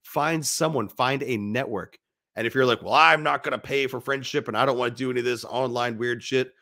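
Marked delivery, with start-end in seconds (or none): none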